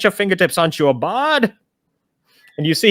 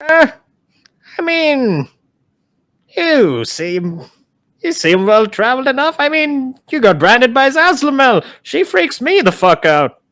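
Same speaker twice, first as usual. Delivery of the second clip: lich voice; 8 semitones higher, slower than usual. Acted. Lich answers Jasper.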